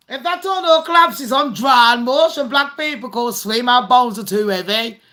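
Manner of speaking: ordinary talk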